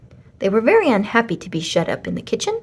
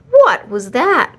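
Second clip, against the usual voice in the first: slow, loud startled cry as Madame; 4 semitones higher, 7 dB louder, slower than usual. A narrator's reading. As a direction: Madame calls out in alarm, louder. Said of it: "cried Madame".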